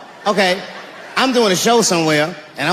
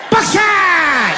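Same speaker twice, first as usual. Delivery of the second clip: triumphant shout; 9 semitones higher than usual. Acted. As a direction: announce the shell with glee. Racking heavier ammo.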